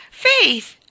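second Faith! shrieked in panic; terrified scream. A narrator's reading. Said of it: "gridò Lois in preda al terrore".

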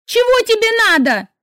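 high, loud angry shout; furious yell. A narrator's reading. Sister screaming angrily at her pestering brother. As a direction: yell at him in rage. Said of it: "заорала я со злостью".

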